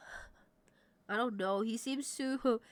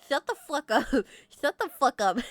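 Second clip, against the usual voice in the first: laughing shout; 3 semitones higher, 8 dB louder than usual. Real, not acted. Laughing protest at chat.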